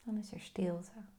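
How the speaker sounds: soft breathy whisper into stillness. Acted. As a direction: barely whisper the silence. Meditation, pointing to the silence.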